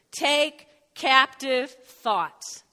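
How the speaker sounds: very slow, each word spaced; slow dictation. Shown as a demonstration. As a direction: dictate it slowly for notes. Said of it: "write this down".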